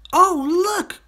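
put-on high, loud child's cry of discovery; a boy spotting something wonderful; excited cry. A narrator's reading. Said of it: "cried Toto".

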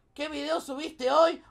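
ordinary talk, nothing marked

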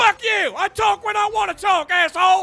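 barking army drill instructor; drill sergeant voice. Acted. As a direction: yell like an angry drill sergeant.

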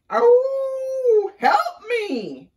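loud, high wolf wail; howling plea for help. A narrator's reading. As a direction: howl it out, then beg for help.

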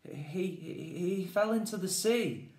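high, shaken stammer. Acted. Charlie stammering to Jazz in shock, high and halting. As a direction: stumble over the words in shock. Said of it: "stammered Charlie".

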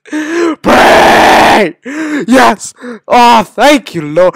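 high, loud cries of thanks; exultant shouting. Real, not acted. Gamer thanking the Lord for progress, exultant shouting. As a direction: shout praise in relief. Mock praying, briefly succeeds.

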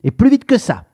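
high, loud, snappish order; grumpy customer snapping at the shopkeeper; curt and impatient. A narrator's reading. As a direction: hurry him up rudely. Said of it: "d'un ton sec".

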